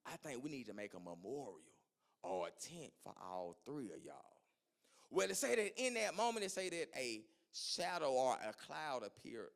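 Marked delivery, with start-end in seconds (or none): 0.0-4.4 much softer and lower, confiding; a low aside to Jesus; leaning in quietly. Retold or imitated. Peter leans over to Jesus: soft, low confiding. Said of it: "Peter leaned over into Jesus and said".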